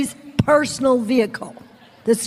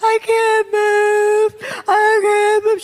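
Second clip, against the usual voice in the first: higher, slow and strained, begging for help; helpless panic; 8 semitones higher, 4 dB louder, slower than usual. Retold or imitated. Woman stuck on the floor pleading with a helper, high and slow.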